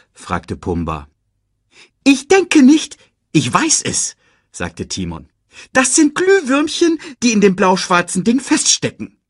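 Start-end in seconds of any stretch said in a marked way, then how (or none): 2.0-4.2 high, quick cartoon meerkat; chirpy little sidekick voice. Timon answers. 5.7-9.2 high, quick cartoon meerkat; chirpy little sidekick voice. Timon explains.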